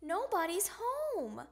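put-on high, complaining kid voice; plaintive cry. A narrator's reading. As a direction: complain like a let-down child. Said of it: "cried Froggy".

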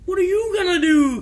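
high, loud put-on voice; high-voiced weaselly guy; shifty-guy impression. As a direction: pitch it way up like a slippery character. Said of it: "He reminds me of".

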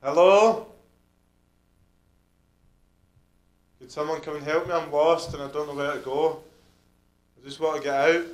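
loud, pretend plea for rescue; calling for help. Investigator plays someone lost to lure spirits, calling for help. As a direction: call out as if lost in the building.

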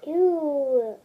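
ordinary talk

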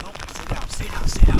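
loud, urgent yell at a fish; urgent shout. Real, not acted. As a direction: shout it over the wind, urgent. Yelling at jumping fish.

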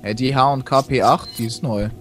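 ordinary talk

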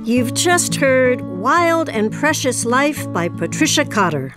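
ordinary talk, nothing marked

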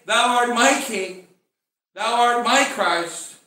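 loud, slow declaration of faith; emphatic preacher's confession, drawn out; proclamation. Acted. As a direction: proclaim each phrase slowly and firmly.

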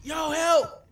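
high terrified screams while fleeing; panicked screaming. Real, not acted. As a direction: scream for help in real panic.

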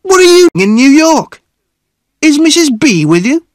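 high, loud, excited questioning; loud cry. A narrator's reading. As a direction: boom out questions at an acquaintance. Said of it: "he cried loudly".

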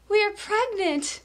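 slow, high sugary announcement; mock smug couple. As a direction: gush like a proud couple. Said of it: "couples are like".

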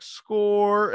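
high, loud mock cheer of victory; hammed-up score shout; triumphant movie cheer. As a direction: yell it like you just won. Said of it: "And he's like".

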